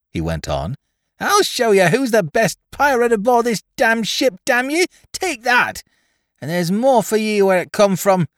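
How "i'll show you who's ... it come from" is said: put-on much higher, louder drunken screaming; wild shrill yell. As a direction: scream like a crazed drunk boy. Drunk boy shooting and cursing.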